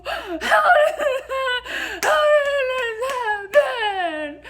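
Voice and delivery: very high, drawn-out mock wail; hysterical wail. Wife reacting to the surprise pregnancy, wailing in disbelief. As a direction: cry it out in squeaky disbelief.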